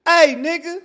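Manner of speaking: very high, loud imitated holler; mimicked street call from a distance; distant yell. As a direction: yell as if from far down the block. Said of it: "you just hear it off in the distance".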